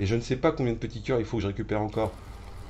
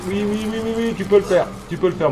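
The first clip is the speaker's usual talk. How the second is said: high, louder self-encouragement; excited cheering; 9 semitones higher, 4 dB louder than usual. Real, not acted. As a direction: pump yourself up, pitched high. Cheering himself on in game. (encouragement excité et aigu)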